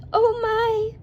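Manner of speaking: high-pitched exclamation of alarm; startled cry. A narrator's reading. Goldilocks wakes to three bears over her, startled cry. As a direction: cry out high, taken aback. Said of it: "cried Goldilocks".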